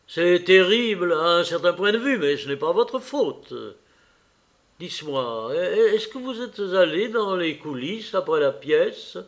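lightly voiced Lord Henry. A narrator's reading. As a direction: console him with easy poise.

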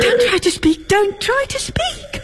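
high, crying plea; sobbing, begging him; tearful. Acted. Wife crying over husband.

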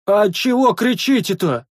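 high, drawn-out call; loud inquiring shout. A narrator's reading. Second sentry across the wall, shouting a question. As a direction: holler a question from afar. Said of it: "Столько же громким криком поинтересовался".

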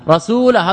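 faulty rasulan; accusative rasulan. Shown as a demonstration. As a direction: hit the -an of رسولا. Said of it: "لو نصب رسولا ولم يرفع".

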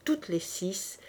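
usual speaking voice

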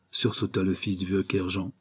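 plain reading voice

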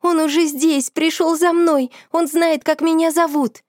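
panicked fear. Acted. Friend panics, spirit knows her name.

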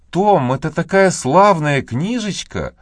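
put-on bright, eager young girl's exclamation; exclaiming girl. A narrator's reading. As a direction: gush it out like an excited little girl. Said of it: "воскликнула Мэгги".

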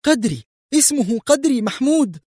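very high, distressed answer; pained cry. A narrator's reading. Woman in an arm hold giving a name, pained. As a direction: blurt the name as if hurting. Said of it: "هتفت في ألم".

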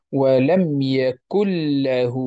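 "ولم يكن له" slowly, n of "يكن" merged into a doubled l; إدغام demo. Shown as a demonstration. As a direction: recite "ولم يكن له" as yakul-lahu. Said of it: "يصبحان حرفا واحدا مشددا". ("ولم يكن له" بإدغام النون في لام مشددة)

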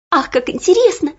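high, bright delighted gasp; light up with delight; excited exclamation. Acted. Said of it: "воскликнула Оля".

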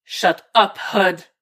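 sharp, irritated snap; curt reprimand; snapping. A narrator's reading. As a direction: snap at a teammate to shut up. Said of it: "Oracle snaps".

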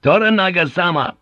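high, loud invocation of a name; gruff command. Acted. Pilot confronting samurai with his overlord's name, gruff command. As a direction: call it out to assert authority. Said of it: "to the samurai he said gruffly".

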